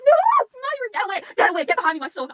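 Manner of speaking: high, loud protective cry; panicked shouting. Acted. Fan yelling to Mahiru to get away, panicked. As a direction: shout urgently to pull him to safety.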